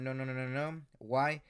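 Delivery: ordinary talk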